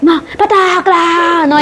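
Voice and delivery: hammed-up grief over a fallen friend; teacher acting out Achilles crying out in despair; desperate cry. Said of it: "y grita desesperado".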